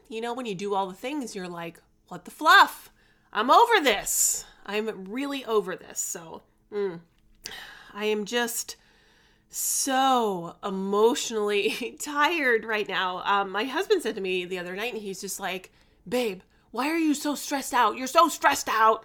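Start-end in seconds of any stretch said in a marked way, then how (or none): 2.1-4.5 higher, louder fed-up outburst; a burst of comic frustration; retold exasperation. 16.1-19.0 lightly voiced husband nagging his wife; mildly mocking partner voice; imitating husband. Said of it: "you talking like that".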